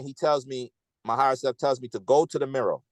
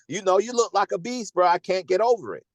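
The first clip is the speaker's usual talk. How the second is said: amused disbelief; 7 semitones higher than usual. Retold or imitated. Retold remark, laughing disbelief.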